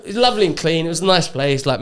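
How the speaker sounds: normal talking voice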